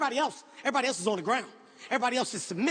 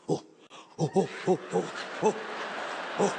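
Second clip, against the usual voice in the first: gorilla hoots; 8 semitones lower than usual. Retold or imitated. Facing the silverback, copying the guide's gorilla hoots. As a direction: hoot low like a submitting gorilla. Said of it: "did everything Antoine said".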